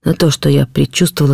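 ordinary delivery, nothing marked

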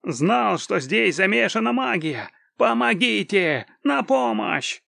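high cries, drawn out toward the end; panicked wail. A narrator's reading. Terrified spy calls for help against magic, wailing high. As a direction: wail in alarm, then cry out for help. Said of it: "завопил Ингольд".

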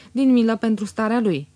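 plain reading voice

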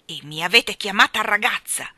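loud, pointed reproach; merciless. A narrator's reading. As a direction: press the charge without pity. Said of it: "continuò Mary Poppins senza pietà". (spietata, accusa decisa)

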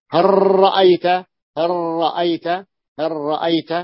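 هل رأيت with the lam merged into a doubled ra. Shown as a demonstration. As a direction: say "har-ra'ayt". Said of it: "فأدغمت اللام في الراء".

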